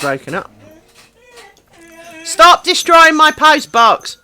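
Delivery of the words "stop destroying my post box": loud, slow telling-off of the dog; loud slow scold to a pet; scolding shout. Real, not acted. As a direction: bark it out at the dog.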